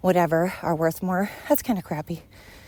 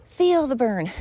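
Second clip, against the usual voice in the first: higher, louder peppy workout coach cheer; aerobics instructor; 6 semitones higher, 4 dB louder, slower than usual. Retold or imitated. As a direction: pump it up like a fitness instructor. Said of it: "Is Richard Simmons still alive?".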